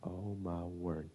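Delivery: very low, slow, quiet amazement; stunned hush. Retold or imitated. Onlooker watching a kid about to erupt, stunned hush. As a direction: breathe it out slowly in mock dread.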